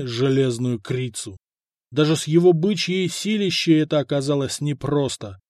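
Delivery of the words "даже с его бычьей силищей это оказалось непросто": ordinary delivery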